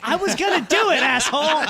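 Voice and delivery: loud, high protest through laughter; laughing protest. Real, not acted. Podcaster to friends who stole his line, loud laughing protest. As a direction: shout it half laughing at being beaten to it.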